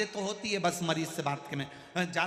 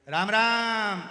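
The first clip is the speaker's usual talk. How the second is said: loud shouted greeting; 6 semitones higher, 11 dB louder, much slower than usual. Retold or imitated. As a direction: bellow the greeting high and loud. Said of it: "चिल्ला के बोलता है". (ज़ोर से चिल्लाकर राम राम)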